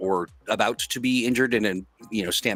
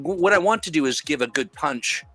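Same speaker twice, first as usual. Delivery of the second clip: normal talking voice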